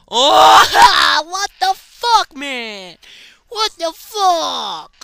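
high, loud, slow disbelieving yell; laugh-scream at the absurd death; real startled outcry with a laugh; shocked laughing shout. Real, not acted. Dies again, laughs in shock.

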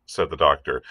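ordinary delivery, nothing marked

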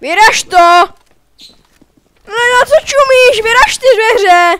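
loud impatient urging; impatient shout. Real, not acted. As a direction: yell at him to break it. Urging teammate to break it.

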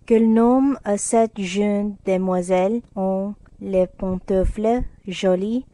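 plain reading voice

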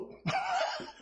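short high giggle; high laugh. Real, not acted. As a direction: break into a quick laugh. Laughs after telling story.

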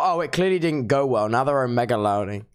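usual speaking voice

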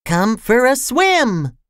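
high, small-voiced call; high little storybook voice; little cry. A narrator's reading. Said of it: "cried Mister Small".

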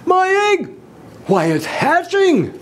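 very high, thrilled shouting; high, swelling excited exclamation; Horton. A narrator's reading. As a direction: cry out in amazed excitement. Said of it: "shouted Horton".

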